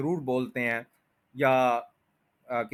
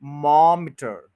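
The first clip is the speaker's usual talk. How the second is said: मॉमीटर with loud, long MAAM; practice drill; 7 dB louder, much slower than usual. Shown as a demonstration. Teacher offers an easier practice piece, strong stress. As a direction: hit MAAM hard, then a short "iter" in मॉमीटर. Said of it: "बस इतना practice कर लें".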